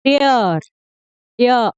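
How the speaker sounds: डेयर ending in a drawn 'ay-uh' glide; glide sound. Shown as a demonstration. Teacher isolates the sound for learners, glide sound. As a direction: stretch डेयर, then isolate its 'ya' ending. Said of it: "य का sound आ रहा है".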